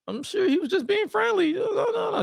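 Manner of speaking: very high, squeaky pleading voice; high-pitched imitation of a pleading woman. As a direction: plead in a high mock falsetto. Acted quote of woman pleading.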